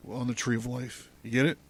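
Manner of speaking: usual speaking voice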